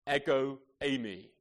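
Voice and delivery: ordinary talk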